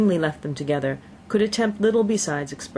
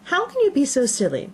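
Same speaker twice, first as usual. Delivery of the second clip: mother crying out, higher and exclaiming; 9 semitones higher than usual. Acted. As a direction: cry out in scolding disbelief. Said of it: "cried her mother".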